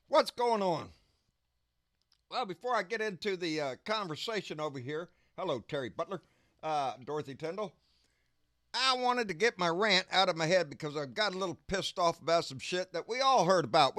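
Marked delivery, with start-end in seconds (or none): none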